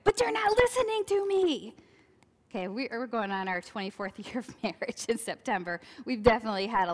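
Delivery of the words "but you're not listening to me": mock exasperated plea. Acted marital quarrel retold.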